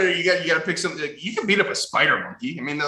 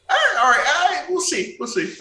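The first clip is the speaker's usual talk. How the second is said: laughing; 4 dB louder than usual. Real, not acted. Laughing amid banter about apes.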